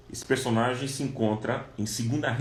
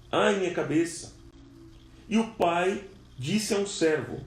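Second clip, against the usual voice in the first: raised, urgent sermon storytelling; dramatic scripture reading; 6 semitones higher than usual. Acted. Preacher reading Second Kings to the congregation, dramatic and raised. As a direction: tell the Bible story with mounting drama.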